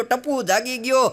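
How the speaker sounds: ordinary delivery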